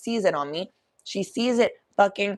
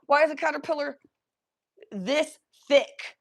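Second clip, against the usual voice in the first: disgusted; 8 semitones higher, 3 dB louder than usual. Real, not acted. Disgusted at huge caterpillar.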